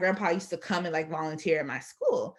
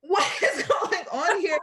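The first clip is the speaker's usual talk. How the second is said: baffled; 9 semitones higher, 4 dB louder, slower than usual. Retold or imitated. Baffled, laughing.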